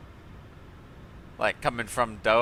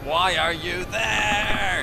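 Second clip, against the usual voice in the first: slow, high, drawn-out disbelief; baffled at the pilot; exasperated; 8 semitones higher, 5 dB louder, much slower than usual. Real, not acted. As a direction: stretch it out in disbelief. Pilot on wrong frequency.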